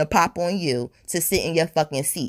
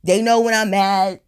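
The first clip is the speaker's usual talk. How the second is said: usual speaking voice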